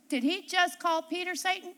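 ordinary talk, nothing marked

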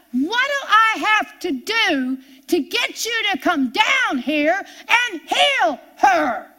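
high, loud, desperate cry upward, slowing at the end; frustrated shout to God. Retold or imitated. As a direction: yell at heaven, fed up and pleading. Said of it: "And I said to God".

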